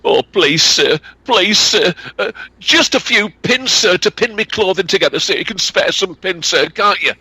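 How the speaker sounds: put-on high pleading young lass; high-pitched pleading girl's voice; begging girl. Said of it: "Alison begged of him".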